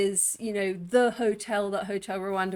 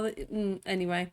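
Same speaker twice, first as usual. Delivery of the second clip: normal talking voice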